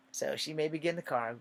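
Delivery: normal talking voice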